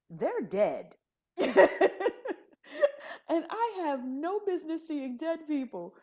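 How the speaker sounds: loud, high laugh then a laughing line; cracking up at the punchline. Real, not acted. Telling the host about the dead, laughing.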